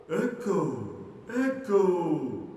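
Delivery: high, distant returning calls; faraway echo of a yell; echo shouting back. Acted. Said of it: "shouted the echo".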